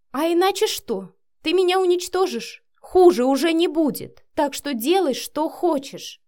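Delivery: high, loud, heated challenge; defiant outburst. A narrator's reading. (с вызовом, на повышенных тонах)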